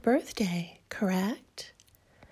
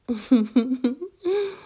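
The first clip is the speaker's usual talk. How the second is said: wicked giggle; 4 semitones higher, 8 dB louder than usual. Acted. Fairy gloats over her visit.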